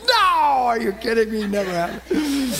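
loud, high-pitched protest; mock outraged cry. Woman refusing to lose the game, mock outraged cry. Retelling her outburst amid laughter.

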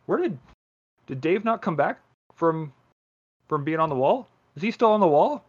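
hammed-up voice of a careless guard; lazy barracks guard. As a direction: play a shrugging soldier who can't be bothered. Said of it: "Can you imagine sitting there in the barracks and be like".

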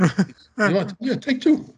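lightly voiced generous host, egging them on; imitated host. Host urging the young band to take two girls, lightly mock-urging.

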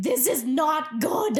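high, slow mock-alarmed dog voice; voicing the worried dog. Host speaking for the dog sensing their energy, mock alarm. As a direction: draw it out high as the panicking dog. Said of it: "she was just like".

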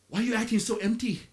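ordinary delivery

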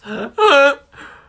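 high, loud goose honk. Shown as a demonstration. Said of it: "this is my impression of a goose".

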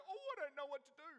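hammed-up flustered God; mock panicking God. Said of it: "freaking out, going".

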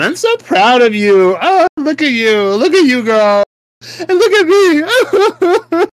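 gushing praise. Pretend. Praising her friend, hammed-up excited squeal. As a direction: gush over her like a proud fan.

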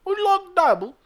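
very high, loud mock barmaid accent; shrill up into a put-on British barmaid; mock British bartender. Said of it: "she goes".

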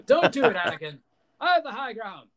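high, loud dramatic movie quote; hammed-up warning to a foe; mock Obi-Wan. Mocking the high ground scene, high hammed-up warning. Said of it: "I don't really like the high ground thing".

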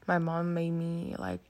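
ordinary talk

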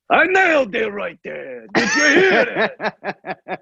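high-pitched mock boast, last words drawn out; exultant Pacino. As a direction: crow it like a thrilled actor leaving set. Imagines Pacino exulting after set.

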